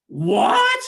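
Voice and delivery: loud incredulous outburst; outraged father. Acted. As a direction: blurt it in outrage. Father hears sword given away.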